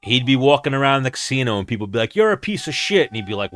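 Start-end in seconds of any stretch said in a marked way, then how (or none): 2.1-3.1 high, jeering stranger's insult; lightly voiced stranger sneering; mock stranger insult. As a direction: toss it off like a passing heckler. Strangers insulting him in casino.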